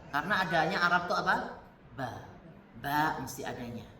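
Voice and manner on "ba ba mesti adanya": lightly voiced "Ba" standing in for p. Teacher showing how Arabs turn p into b. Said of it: "P itu mesti ba".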